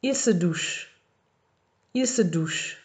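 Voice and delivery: Il se douche read twice, clearly; model French phrase. Shown as a demonstration. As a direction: read it out for the class. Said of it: "Numéro deux".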